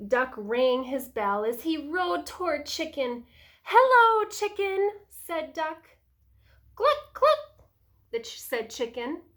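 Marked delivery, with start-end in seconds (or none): 3.6-4.9 Duck calling hello. A narrator's reading. Duck greets Chicken in story. 6.7-7.7 very high, loud clucks; chicken clucking. A narrator's reading. Chicken noise in story.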